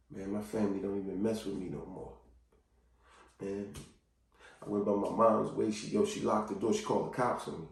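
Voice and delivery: low, very soft admission; subdued, acted heartache; downcast confession. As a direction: voice someone hurting inside. Voicing hurt person's hidden confession.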